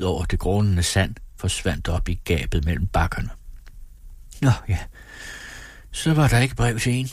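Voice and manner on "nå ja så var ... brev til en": mild sigh of disappointment; sighing resignation. A narrator's reading. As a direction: let it go with a light, resigned sigh. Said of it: "sukkede Malene". (let sukkende og resigneret)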